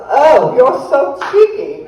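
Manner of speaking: mock-prim teasing remark; teasing clergywoman. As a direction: play the amused reverend wagging a finger. Reverend teasing her in retold story.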